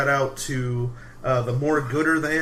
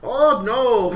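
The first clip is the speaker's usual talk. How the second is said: mock Minnesota accent; 9 semitones higher, 6 dB louder than usual.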